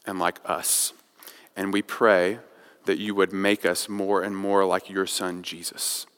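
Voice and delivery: quiet, reverent prayer voice, fading low; closing prayer. Acted. Pastor closing the sermon in prayer, soft and reverent. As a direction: pray softly over the congregation.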